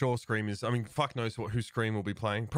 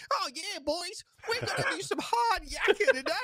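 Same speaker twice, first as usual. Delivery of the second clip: high, laughing outback Australian bloke; broad Australian accent. Retold or imitated. Mocking Skye's voice as a Cairns Australian, high broad accent. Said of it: "from fucking Cairns".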